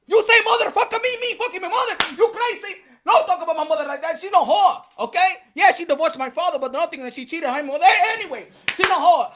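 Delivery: high, loud mock Mexican accent, indignant and laughing; Canelo imitation. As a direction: defend your mother in broken accented English. Imitating Canelo defending his mother.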